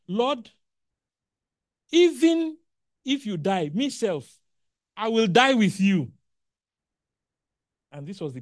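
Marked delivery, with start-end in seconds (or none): 0.1-6.1 high, loud boastful vow of loyalty; bold loud vow. Acted. Peter loudly swearing to Jesus he will die with him, brash and high.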